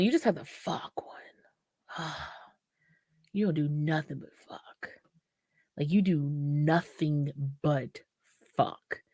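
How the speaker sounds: breathy conspiratorial whisper. Real, not acted. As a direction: whisper it like a naughty secret. Confiding about the casual partner.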